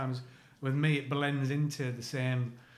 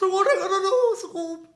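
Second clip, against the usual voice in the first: high, loud mash-up cartoon voice; Shaggy from Scooby-Doo; 9 dB louder than usual. Attempting all three impressions at once, loud high cartoon voice. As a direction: blast the catchphrase out in a squeak. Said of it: "so all three. So it's like".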